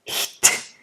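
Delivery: whispered "hit"; whispered consonant demo. Shown as a demonstration. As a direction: breathe out "hit" with no voice. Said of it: "we need to hear the hit".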